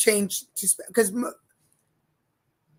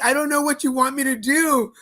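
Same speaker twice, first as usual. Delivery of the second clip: higher, louder pleading at the clerk; helpless exasperation; 5 semitones higher, 5 dB louder than usual. Retold or imitated. Tourist pleads with the hotel clerk, helpless exasperation. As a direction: throw up your hands verbally. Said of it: "I'm like".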